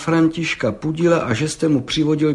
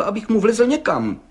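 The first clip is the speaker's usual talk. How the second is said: plain reading voice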